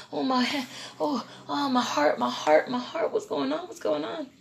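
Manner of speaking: high, soft whimpering fret; acted panic. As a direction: whimper it in small frantic bursts. Acts out ambulance panic.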